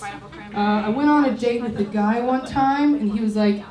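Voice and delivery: ordinary talk, nothing marked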